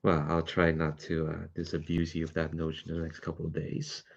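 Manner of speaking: soft, low in-character reply; wry, modest heroine. Acted. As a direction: answer quietly, almost to yourself.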